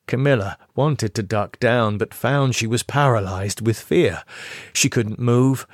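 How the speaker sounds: ordinary delivery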